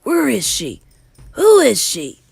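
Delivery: loud, rising threats; vengeful outburst. Acted. Bruno vows revenge on the witch, loud and furious. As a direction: demand her name, out for revenge.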